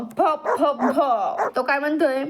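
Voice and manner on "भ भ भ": playful imitation of a dog's bark. Shown as a demonstration. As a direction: bark like a dog for the children. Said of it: "like a dog". (कुत्र्यासारखं भुंकण्याची नक्कल)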